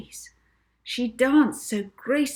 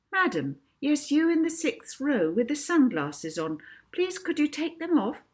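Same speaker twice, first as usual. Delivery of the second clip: higher, bright polite request to a lady in the crowd; polite stage request; 7 semitones higher than usual. Acted. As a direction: coax her kindly to remove the sunglasses. Molly addresses woman in audience.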